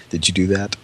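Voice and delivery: asking through a laugh. Real, not acted. Laughing question about whacking the orange.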